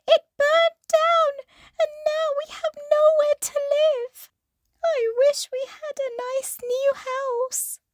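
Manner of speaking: very high, loud mouse squeak; squeaky mouse voice. Acted. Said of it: "squeaked the mother mouse".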